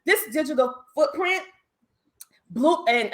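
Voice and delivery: usual speaking voice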